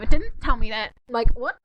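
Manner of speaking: normal talking voice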